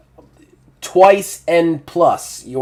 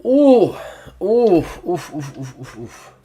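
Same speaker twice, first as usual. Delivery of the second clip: goaded reaction; 6 semitones higher, 4 dB louder, slower than usual. Real, not acted. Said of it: "let a little kitty cat tell you".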